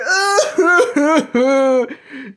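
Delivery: high cackling laugh. Real, not acted. Vlogger laughing at his own impression of critics, cackling. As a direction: burst out laughing at the mockery.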